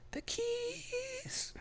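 tiny high squeak of panic; panicked squeak. Retold or imitated. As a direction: squeal it like a scared kid. Mock panic over the keys.

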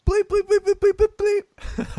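very high, repeated bleeping; high-pitched electronic-style beeps by mouth; imitated censor bleep. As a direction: mimic a censor tone over swearing. Imitating censor bleeps after swearing.

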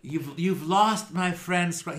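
smug. Acted. Tarina telling Skruldar he lost.

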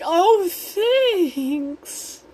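high, strained, drawn-out politeness; fake-polite thanks. Luce thanks Amity for a pie she hates, forced politeness. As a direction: squeeze it out high, hiding that you hate it. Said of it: "obviously tell Luce hates it".

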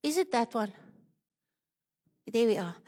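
normal talking voice

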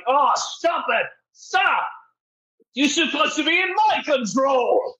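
high, shouted villain panic. Acted. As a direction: yell as the cornered villain. GM voices shadow villain.

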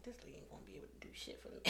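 fast, very soft whisper; retold skeptical aside. Walking in to see the therapist, skeptical aside. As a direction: whisper it quickly like a private thought. Doubting inner thought about therapist.

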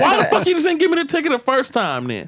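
high-pitched indignant retort; indignant complaint. Retold or imitated. Driver challenging cop over the ticket, high indignant complaint. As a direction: throw the question back, high and aggrieved.

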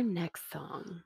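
unvoiced whisper; just breathe the words. Real, not acted. Whispered joke before next song.

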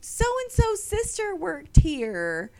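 high, slow, sugary inquiry; prim vendor lady. Retold or imitated. Vendor asks about her sister, high and slow. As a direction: mimic the vendor lady's sweet tone. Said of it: "asked me".

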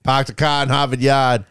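put-on dropped R's, Boston accent; Boston accent, slow on the question. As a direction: pahk the cah like a Bostonian. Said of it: "Boston accents".

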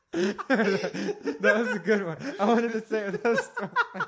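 high, loud laughing talk. Real, not acted. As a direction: talk through big real laughter. Laughing at his friend's impression.

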